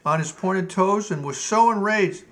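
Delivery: ordinary delivery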